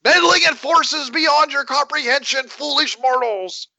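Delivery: grand, high menacing villain speech; booming echoing villain monologue. Acted. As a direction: declaim like a cosmic overlord.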